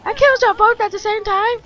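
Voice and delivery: high, loud and fast; excited disbelief. Real, not acted. Gamer cheering a double kill, excited and fast. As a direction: brag in thrilled surprise.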